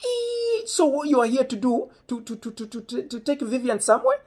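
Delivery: high, stuttering mock father; imitating inquisitive dad. Friend's dad questioning the boy about his daughter, high imitation. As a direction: squeal the opening, then stammer out high questions.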